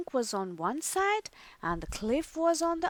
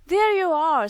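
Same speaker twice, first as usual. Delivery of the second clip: louder, higher welcome; relieved greeting; 6 semitones higher, 8 dB louder than usual. A narrator's reading. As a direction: call out with relief. Mother greets returning boys.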